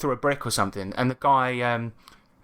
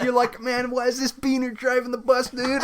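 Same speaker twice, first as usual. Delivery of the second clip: much higher, put-on dumb bro voice; 8 semitones higher than usual. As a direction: ham up a clueless dude GM. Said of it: "that's how he spoke".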